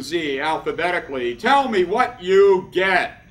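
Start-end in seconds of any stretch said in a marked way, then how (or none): 1.3-3.2 slow, loud, deliberate demand; emphatic call to the group; calling for response. Acted. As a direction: draw out each word loudly to rouse a reply. Leader calls for audience response.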